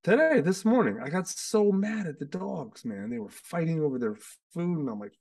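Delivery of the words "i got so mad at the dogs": ordinary talk, nothing marked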